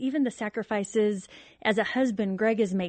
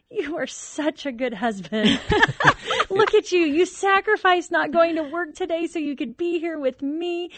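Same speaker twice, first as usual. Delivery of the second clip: high, loud, laughing gush at her husband; woman doting on husband after sedation, laughing and loud; giddy drugged wife; 7 semitones higher, 5 dB louder than usual. Retold or imitated. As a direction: play it tipsy and adoring, much higher. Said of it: "I looked up at Greg and I, I said".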